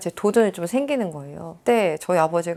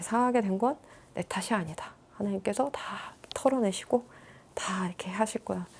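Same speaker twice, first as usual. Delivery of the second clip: whispered self-reassurance; 7 dB quieter than usual. Real, not acted. Reassuring herself about God's help.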